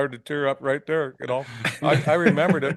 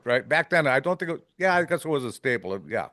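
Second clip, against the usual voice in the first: usual speaking voice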